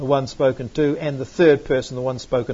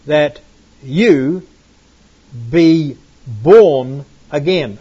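slow, louder, "you" pressed; stress on you; 6 dB louder, much slower than usual. Shown as a demonstration. Teacher points out plural "you" to class, stressing it. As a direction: read "that you be born again" slowly, weight on "you". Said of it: "That there is plural".

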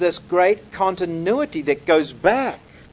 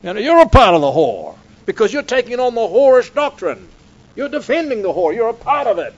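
loud, higher mock condemnation; hammed-up zealous denunciation; accusing preachers; 5 semitones higher, 9 dB louder than usual. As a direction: rail at the doubter like a hellfire preacher. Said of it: "they would say".

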